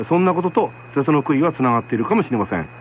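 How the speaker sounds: normal talking voice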